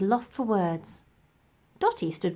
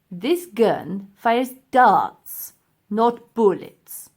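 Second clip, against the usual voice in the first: slow, sinister professor; menacing; 6 dB louder, much slower than usual. Acted. Professor pointing a pistol at the mammoth, slow menace. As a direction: drawl the threat slowly.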